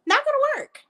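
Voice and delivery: high, squeaky-pitched verdict; high-pitched dismissal. Real, not acted. Insisting the dictionary will not help, high-pitched dismissal. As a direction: jump way up high on the repeat.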